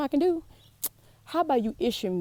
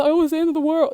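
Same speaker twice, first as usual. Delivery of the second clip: hammed-up doom, higher and louder; mock doom; 9 semitones higher, 8 dB louder, faster than usual. Mocking panicked listeners about the crash, hammed-up wail. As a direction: wail it like the world is ending. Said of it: "So when y'all over here".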